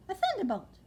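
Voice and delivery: high, loud amazed exclamation; startled cry. Acted. Old lady startled by Plop landing, crying out. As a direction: gasp at the sky falling. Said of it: "cried the old lady".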